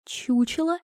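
hushed rising disbelief; stunned echo. A narrator's reading. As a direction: repeat it quietly, incredulous. Princess echoes insult in disbelief.

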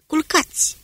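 high, sharp order to hit the ground; urgent command. Acted. Smith orders the men down, urgent. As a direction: bark it quick.

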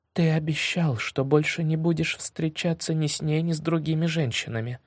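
shaky hushed whisper, close to tears; trembling whisper. Acted. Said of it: "дрожащим голосом прошептала Ария".

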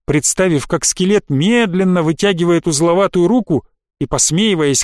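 ordinary delivery, nothing marked

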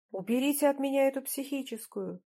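understated yell for help; mild mock yell. A narrator's reading. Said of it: "упёрся спиной в сиденье и заорал". (сдержанно изображая крик, повыше)

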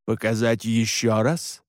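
slow, composed low-key question; teasing offer. Acted. Alexander offering frightened Alan another look, slow and cool. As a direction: draw the offer out coolly.

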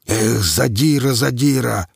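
"задира, задира" dragged out in a mild growl; captain scolding the commandant in a mild growl. A narrator's reading. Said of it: "прорычал Квас".

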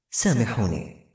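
ordinary delivery, nothing marked

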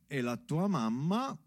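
ordinary talk

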